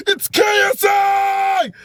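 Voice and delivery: high excited squeal; inner starstruck scream. Retold or imitated. Starstruck fan screaming at meeting [name]. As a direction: fan screaming inside his head. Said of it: "That's what I'm saying in my head".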